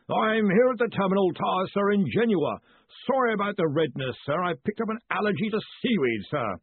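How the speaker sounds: high-pitched deferential character voice; high, apologetic underling; nervous caller. A narrator's reading. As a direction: report earnestly to a superior over a long distance. Collarbone reports from afar.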